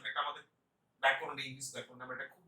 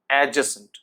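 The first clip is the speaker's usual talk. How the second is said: ordinary talk, nothing marked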